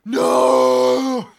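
long, high mock wail; hammed-up drawn-out shout; impression of someone they know. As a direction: howl it out, high and loud. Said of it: "my best impression of a certain".

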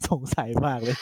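words half laughed through; amused, laughing it out. Real, not acted. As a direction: chuckle while saying it. Laughing at episode title.

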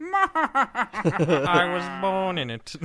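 very slow, laughing villain line; Bane impression. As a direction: drag it out, cracking up. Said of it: "Pretty good".

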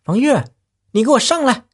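high-pitched call down into the cellar. Acted. Wife calls husband up from the cellar, high sharp call. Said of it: "站在窖口喊他".

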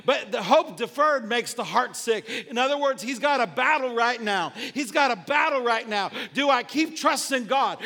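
full-force, driving sermon delivery; impassioned preaching. Real, not acted. Preacher urging people to keep hoping in God, impassioned preaching. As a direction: preach it hard, phrase after phrase.

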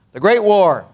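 ordinary talk, nothing marked

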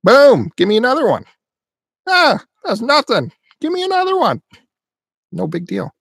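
put-on swagger like a fearless little boy; hammed-up child bravado; tough kid bravado. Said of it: "They're like".

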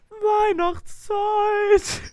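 high, very slow stretched call; drawn-out high announcement; stretched jingle. Shown as a demonstration. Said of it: "wollen wir noch mal sagen".

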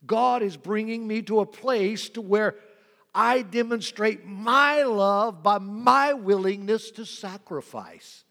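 loud, rising preacher's build; full-voiced sermon climax; preaching at full force. Real, not acted. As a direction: drive it home to the congregation.

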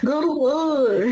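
high, drawn-out mock agreement; hammed-up sarcasm; sarcastic. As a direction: stretch it out in an exaggerated sarcastic lilt. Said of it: "he said it sarcastically".

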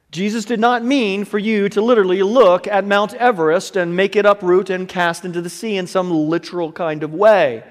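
usual speaking voice

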